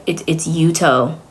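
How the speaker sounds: "Yuto" as YOO-toh, said slowly; slow down and end "Yuto" on "toh"; correct pronunciation. Retold or imitated. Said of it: "doesn't know how to say his name".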